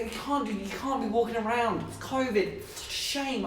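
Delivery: high, soft apologetic waiter voice. Acted. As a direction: mimic staff gently laying down rules. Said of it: "'Cause they go".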